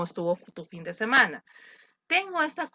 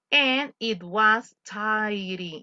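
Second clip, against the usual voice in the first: slow model line; much slower than usual. Shown as a demonstration. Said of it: "model dialogue".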